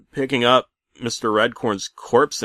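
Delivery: ordinary delivery, nothing marked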